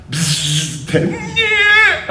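high buzzing taser noise. Retold or imitated. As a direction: zap sound like a taser. Imitating taser sound.

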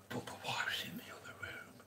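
whispered so the wife won't hear; real whisper. Real, not acted. Hiding from the wife in the next room, whispering. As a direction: drop to a secret whisper.

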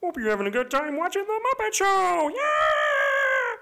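cheery frog puppet greeting rising into a long holler; Kermit the Frog. Acted. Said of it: "Kermit the Frog here".

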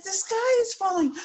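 high, urgent hen voice; panicked warning. Acted. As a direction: cry it out in alarm. Hen warns Turkey.

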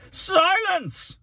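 very high, loud order for silence; commanding cry. A narrator's reading. Mayor silencing an outspoken boy, commanding cry. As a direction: shriek the command to cut him off. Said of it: "cried the mayor".

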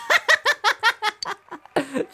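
high cackling giggle; long real laugh. Real, not acted. Gamer laughs at being told not to sound excited, long laugh. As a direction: burst into sustained high laughter.

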